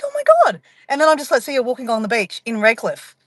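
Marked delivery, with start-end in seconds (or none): 0.0-0.6 imitating her own much higher gasped oh my God; retelling bumping into a friend, mock high surprise. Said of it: "I'm like".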